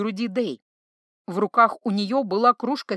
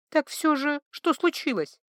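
raspy, weak, raised pitch; hoarse croak; 5 semitones higher than usual. A narrator's reading. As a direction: croak out the question. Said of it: "прохрипела травница". (хриплый шёпот)

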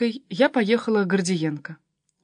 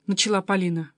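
usual speaking voice

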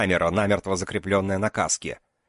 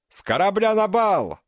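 high, loud yell hiding fear; bravado yell; 12 semitones higher, 3 dB louder than usual. Acted. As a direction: shout it with bravado. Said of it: "орал Азат".

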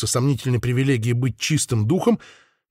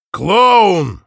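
loud, higher, mocking; scornful shout; 7 semitones higher, 8 dB louder than usual. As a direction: spit it out with contempt. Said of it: "ответил испанец".

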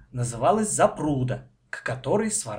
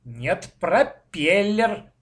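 stubborn, stretched-out contradiction; disagreeing; 5 semitones higher, 4 dB louder, much slower than usual. A narrator's reading. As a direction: dig in, louder on the last word. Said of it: "не согласился Коля".

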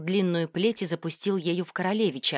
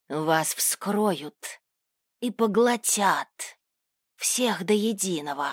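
slow, hissing monster; hissing demon voice; 2 semitones higher, much slower than usual. Acted. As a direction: hiss each word like a demon. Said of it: "раздался шипящий голос пленённого".

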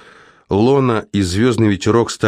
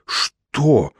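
gasping for breath; 5 semitones higher than usual. A narrator's reading. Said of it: "задыхаясь, выдавил Абитриакс".

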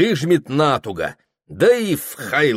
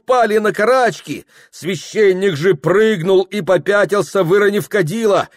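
excited narration; 9 semitones higher, 4 dB louder than usual. A narrator's reading. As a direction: tell the blast with rising alarm. Narration of a cannon blast.

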